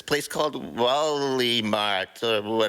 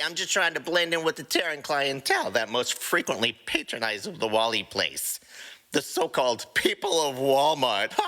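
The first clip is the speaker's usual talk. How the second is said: ordinary delivery, nothing marked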